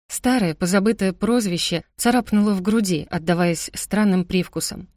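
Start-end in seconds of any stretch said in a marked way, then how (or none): none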